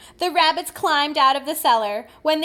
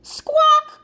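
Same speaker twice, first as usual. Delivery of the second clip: high, loud squawk; sudden high hen cry; 14 semitones higher, 7 dB louder than usual. A narrator's reading. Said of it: "cried the hen".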